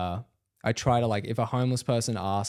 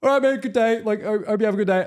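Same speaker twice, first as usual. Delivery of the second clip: nervous goodbye; 10 semitones higher, 4 dB louder, faster than usual. Retold or imitated. Backing away from raging stranger.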